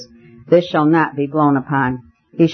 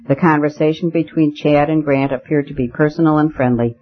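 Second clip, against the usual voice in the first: ordinary delivery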